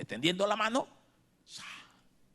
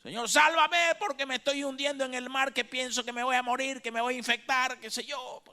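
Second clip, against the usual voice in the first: high, loud desperate plea; a frightened believer crying out in prayer; pleading prayer; 12 semitones higher, 7 dB louder than usual. Acted. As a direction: beg the Lord to save you from sinking.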